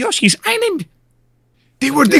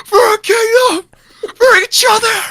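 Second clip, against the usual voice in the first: heroic rallying cry; 10 semitones higher than usual. Retold or imitated. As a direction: cry it out like a hero. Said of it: "acting the hero's speech".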